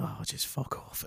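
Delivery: slow whispered fuck off. Retold or imitated. Annoyed traveller whispers at the drinking-game reps. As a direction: breathe it out under your breath. Retold reaction to drinking games.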